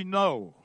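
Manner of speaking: firm, slightly punched "No"; emphatic retold no.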